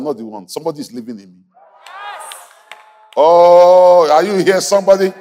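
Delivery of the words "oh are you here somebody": high, shouted pulpit climax; full-force preaching. Real, not acted. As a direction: rouse the congregation, voice raised high.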